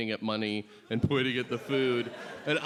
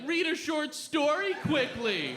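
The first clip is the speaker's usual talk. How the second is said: mocking wimpy dare; 12 semitones higher than usual.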